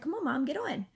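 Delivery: daughter urging mom. Retold or imitated. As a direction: coax like an eager kid. Acting out daughter urging her mother.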